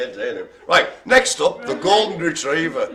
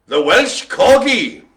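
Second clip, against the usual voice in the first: announcer call; 5 semitones higher, 8 dB louder, slower than usual. Acted. Announcing next dog.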